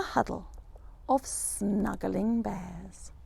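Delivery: very slow hushed ending; soft and slow. A narrator's reading. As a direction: let it melt away slowly.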